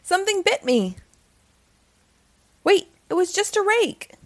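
lively read-aloud storytelling voice; story narration. A narrator's reading. Storyteller reading a Halloween book to children.

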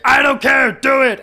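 high, loud shouted defiance; bubble voice. Retold or imitated. Co-host imitates Vicky's defiant bubble-voice shout, high and loud. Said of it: "Vicky again in her bubble voice".

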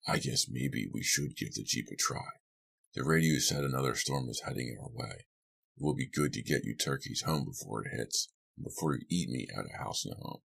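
low, soft grumbling man's voice; quiet, low, teasing character voice; grudging Lou, teasing. A narrator's reading. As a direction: give in grudgingly, keeping it low.

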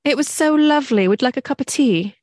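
lovely, genteel British accent. Shown as a demonstration. Offering a cup of tea in put-on British accent. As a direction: play a Brit offering tea. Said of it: "in a nice British accent".

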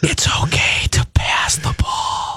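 urgent breathy whisper; frantic whisper. Pretend. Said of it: "No one can hear you".

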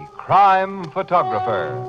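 ordinary talk

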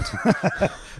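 a high, breathless laugh; breathy high laugh. Real, not acted. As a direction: laugh at your own joke. Laughs at his joke.

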